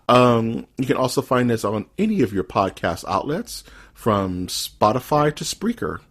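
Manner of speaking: ordinary talk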